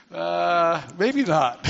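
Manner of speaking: lightly acted hesitant reply; a hint of a dubious dad; doubtful father. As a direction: politely balk at a bad plan. Voices father's doubtful reply to plan.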